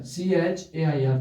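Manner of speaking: teacher slowly spelling a word for students. Shown as a demonstration. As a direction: spell "सी एच ए आई आर" letter by letter, slow. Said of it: "जैसे मैंने लिखा बच्चों chair".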